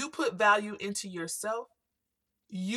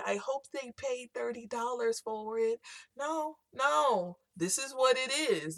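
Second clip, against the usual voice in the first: voiced seller; 5 semitones higher than usual. Voices a hesitant then firm seller.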